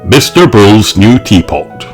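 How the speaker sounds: usual speaking voice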